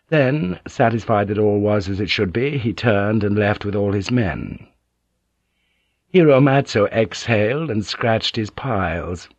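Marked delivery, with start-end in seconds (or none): none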